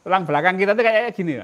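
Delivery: ordinary talk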